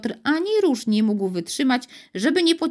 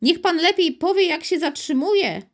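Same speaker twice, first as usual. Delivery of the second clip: a child pretending to be a mysterious voice, higher; childish voice; 8 semitones higher than usual. Invisible child asks the stunned driver how to stop, childlike. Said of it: "Głos brzmiał trochę dziecinnie".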